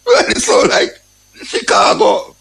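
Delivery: mock exclaim. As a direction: squeak it out in mock amazement. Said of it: "I say".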